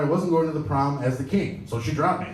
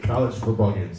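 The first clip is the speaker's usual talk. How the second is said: usual speaking voice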